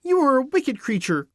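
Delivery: put-on high, angry little-girl voice; high-pitched girlish outrage; indignant cry. A narrator's reading. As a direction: cry out the accusation as an angry child. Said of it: "cried Dorothy".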